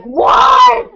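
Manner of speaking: high, loud, startled yelp of disbelief; shocked shout. Retold or imitated. Husband hearing she called nine one one, shouts in shock. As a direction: bark out a stunned what.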